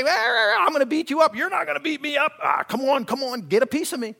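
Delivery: hammed-up quarrel voice; mock tough-guy taunt. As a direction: taunt him to come on. Acted road quarrel.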